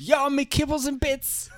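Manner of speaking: put-on high, loud English accent drifting Rastafarian; hammed-up, way-off British accent; botched English accent. Mocking British passengers, badly faked English accent. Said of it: "I don't know why they're Rastafarian".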